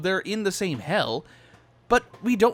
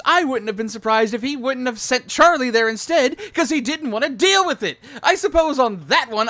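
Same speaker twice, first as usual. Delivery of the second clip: louder and higher, building to a shouted finish; heated rant; 3 semitones higher, 6 dB louder than usual. Real, not acted. Host ranting to co-hosts about the show's timeline, building to a loud heated finish. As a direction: get fired up as you make your point. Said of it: "Ranting over for now".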